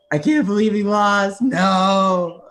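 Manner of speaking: high, loud retold wail of disbelief; mock bawling. Young fan wailing in the arena after his favorite loses, mock bawling. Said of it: "I was bawling, crying in the arena".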